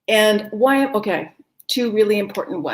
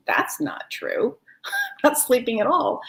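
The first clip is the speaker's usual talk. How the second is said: a hint of the grumbling conscious mind; mocking inner voice; 3 semitones higher than usual. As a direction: play the sceptical mind. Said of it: "your conscious mind is saying".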